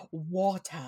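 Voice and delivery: "water" ending in "-tah", r dropped; accent-test "water". Shown as a demonstration. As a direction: drop the final r in "water". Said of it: "test someone's native accent".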